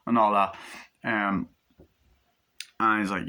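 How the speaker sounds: normal talking voice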